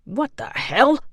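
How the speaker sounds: swearing in surprise; shocked. A narrator's reading. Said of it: "the second man said".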